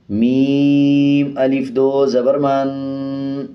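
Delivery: slow, sing-song Qaida spelling chant; slow recitation drill. Shown as a demonstration. Teacher leads children through the Noorani Qaida drill, slow and sing-song. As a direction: chant each syllable slowly for students to repeat.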